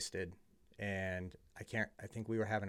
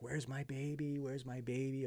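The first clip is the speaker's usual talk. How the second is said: imitating a drunk mother calling out, higher pitched; mock frantic mom; 5 semitones higher than usual. Drunk mom searching the party for her son, mock frantic call. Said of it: "Who is screaming like that?".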